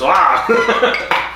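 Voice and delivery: high, loud "Ah!"; retold shriek of fright, played for laughs; mock startled scream. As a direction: yelp as if suddenly spotting something scary. Acts out a fright on noticing.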